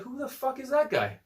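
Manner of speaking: high-pitched, squeaking disbelief; incredulous. Retold or imitated. Coach recounting hearing of a big stranger, incredulous. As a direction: retell it in a high, baffled voice. Retelling his baffled reaction to a stranger.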